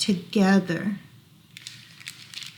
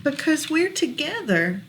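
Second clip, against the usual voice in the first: lilting rhyming read-aloud; reading to children; 3 semitones higher, 3 dB louder than usual. Acted. Parent reading a rhyming book to child, gentle lilt. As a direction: read the rhyme gently to a toddler.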